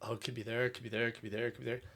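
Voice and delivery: soft, hushed worried thoughts; nervous muttering. Retold or imitated. Shopper eyeing a crowded grocery store, muttering nervously under the breath. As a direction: fret quietly to yourself. Imagining the virus around the store.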